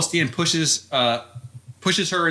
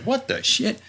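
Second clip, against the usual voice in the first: mock shock; 8 semitones higher than usual. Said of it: "And so he's like".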